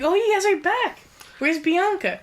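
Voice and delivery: high-pitched retold excitement; eager greeting. Recapper voicing Nico greeting returning friends, eagerly excited. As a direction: chirp it like an eager kid.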